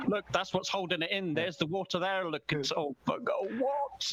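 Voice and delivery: high-pitched mock Scottish accent, indignant quote; bad Scottish accent. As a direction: do a poor impression of a ranting Scot. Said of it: "Those accents are painful".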